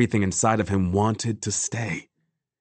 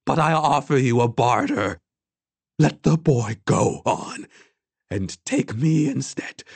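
put-on high, deliberate old woman's voice; bargaining old woman; 4 semitones higher than usual. A narrator's reading. Old woman offers herself for the boy, firm.

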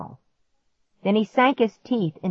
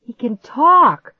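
high, loud, slow astonished gasp; 6 semitones higher, 9 dB louder, slower than usual. A narrator's reading. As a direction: gasp it in shock. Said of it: "Clark gasped".

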